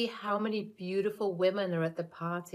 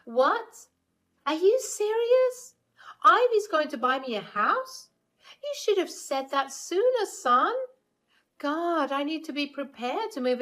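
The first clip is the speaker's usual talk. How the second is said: greedy delight; 8 semitones higher, 4 dB louder than usual. Acted. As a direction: squeal with sudden greed. Mother hears of the house.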